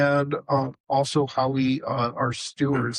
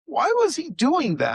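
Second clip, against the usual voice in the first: much higher, baffled question; baffled Honi. Retold or imitated. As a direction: ask it in puzzled disbelief. Honi asks the grandson.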